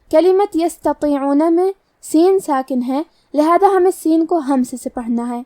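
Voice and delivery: normal talking voice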